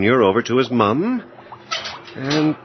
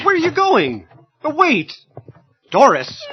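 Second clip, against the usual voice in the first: much higher startled plea; alarmed. Acted. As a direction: call her back in panic. Husband alarmed as wife leaves.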